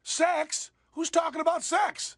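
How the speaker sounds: high-pitched feigned shock; mock surprise. Host reacting to talk of sexual weakness, in mock surprise. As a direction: pipe up as if scandalized.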